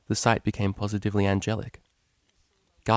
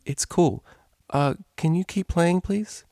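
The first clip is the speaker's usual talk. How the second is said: lightly voiced drowsy unicorn, a bit higher; sleepy DJ; 6 semitones higher than usual. A narrator's reading.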